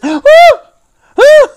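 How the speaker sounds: very high, loud mock "woo"; imitated whoop. Wrestler reacting to his smashed guitar, imitated high whoop. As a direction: let out short piercing hoots. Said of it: "lets out this".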